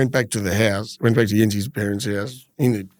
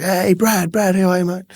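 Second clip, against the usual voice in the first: yelled greeting; 6 semitones higher than usual. Retold or imitated. Restaurant bloke calls out to Brad, imitated yelled greeting. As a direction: imitate a bloke hailing someone he knows. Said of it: "this bloke yells out".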